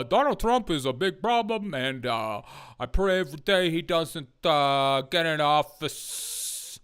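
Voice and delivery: lightly voiced Christie, slowing at the end; mock Chris Christie. Streamer voicing Christie as a CNN pundit, lightly mock. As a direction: drag out the pious ending. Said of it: "Chris, take it away".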